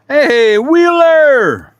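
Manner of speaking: loud, high shout to a friend; big happy shout-out to a viewer; calling out. Real, not acted. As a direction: holler his name across the room.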